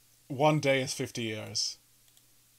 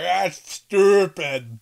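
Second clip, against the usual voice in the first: dismissive; 4 semitones higher, 9 dB louder, much slower than usual. Retold or imitated. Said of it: "They're like".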